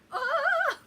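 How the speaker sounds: high, loud imitated scream; loud shriek of ride fright; mock scream. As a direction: friend screaming on the drop. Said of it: "Then she's like".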